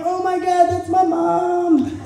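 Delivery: high, slow stunned gasp of recognition; shocked realization. Retold or imitated. Comic realising she was the kid in the movie, slow and stunned. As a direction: let it dawn on you in horror.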